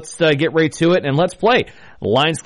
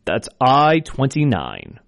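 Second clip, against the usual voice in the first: slow, drawn-out number calling; bingo caller; 2 semitones higher, slower than usual. Acted. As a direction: call each number clearly, then repeat. I will be your bingo caller.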